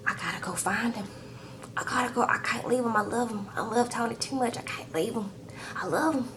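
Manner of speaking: softly voiced, half-whispered pleading, mimicking a heartbroken woman; a hushed, lovesick plea; tearful pleading. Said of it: "Angela is crying or whatever".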